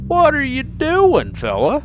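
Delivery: high, loud, startled yell; startled shout. Real, not acted. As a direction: jump and shout in fright. Startled by someone outside.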